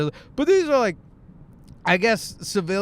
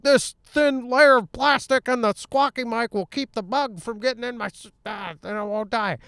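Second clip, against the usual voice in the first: high, squawky voice through a suit mic; 6 semitones higher than usual. Acted. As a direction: play a bubble-suited researcher on a squawky mic. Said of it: "bubble suits where you're like".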